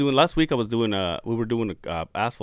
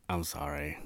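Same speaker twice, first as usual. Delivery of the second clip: very soft, low retold apology; sheepish apology; 8 semitones lower, 16 dB quieter, slower than usual. Podcaster recalling his thought on hearing a coworker listens, sheepish. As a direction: shrink into a small, embarrassed sorry.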